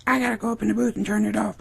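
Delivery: put-on hurried falsetto girl voice; Emma. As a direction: imitate her blurting it out fast and high. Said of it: "She's like".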